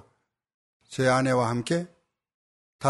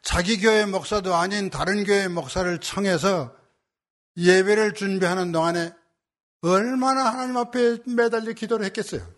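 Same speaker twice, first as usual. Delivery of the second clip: high, sustained sermon cadence; fervent preaching; 6 semitones higher than usual. Real, not acted. Pastor telling the congregation how earnestly she prayed, fervent preaching. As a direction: press each phrase home with rising fervour.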